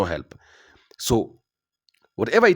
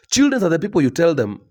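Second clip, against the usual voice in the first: normal talking voice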